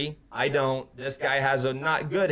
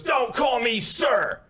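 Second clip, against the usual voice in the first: high, drawn-out mock bellow; imitated angry shout; 8 semitones higher, 3 dB louder, much slower than usual. Storyteller as angry stranger objecting to being called sir, shouted. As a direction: imitate an outraged man shouting each word slowly.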